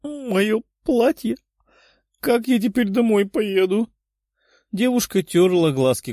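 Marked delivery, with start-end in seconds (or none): 0.0-3.9 high, whimpering wail; tearful. A narrator's reading. Katya weeps over her soaked dress, tearful. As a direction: lament in a high crying voice. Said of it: "как плачет Катя".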